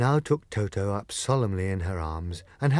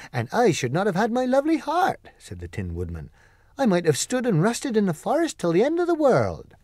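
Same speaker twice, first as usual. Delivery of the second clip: higher, gentle tin man voice, fond and wistful; Tin Woodman voice; 9 semitones higher, 3 dB louder than usual. Acted. Tin Woodman tells Glinda his wish, higher gentle voice. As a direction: play the kindly Tin Woodman longing for his Winkies.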